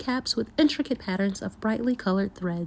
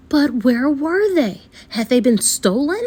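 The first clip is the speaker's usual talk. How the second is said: alarmed; 3 semitones higher, 6 dB louder than usual. A narrator's reading. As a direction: panic creeping into the narration. Hat seller finds hats gone.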